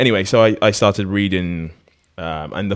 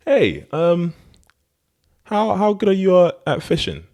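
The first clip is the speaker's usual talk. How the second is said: much higher, tentative acted question; curious businessman; 12 semitones higher, 4 dB louder than usual. Rich businessman sounding out a fisherman about his catch, curious. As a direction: put on a high, inquisitive voice for the businessman.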